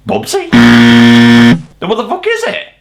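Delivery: ordinary talk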